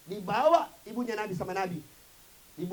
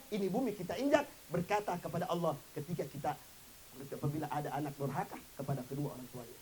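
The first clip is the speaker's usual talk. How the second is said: normal talking voice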